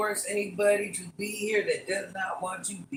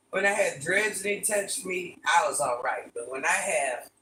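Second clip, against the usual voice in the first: ordinary talk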